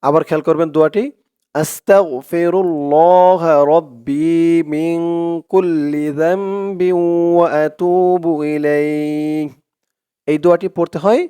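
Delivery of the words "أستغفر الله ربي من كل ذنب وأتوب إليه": slow, drawn-out chanted Arabic recitation. Shown as a demonstration. Teacher reciting a dua for viewers, slow devout chant. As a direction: intone each word long and devout.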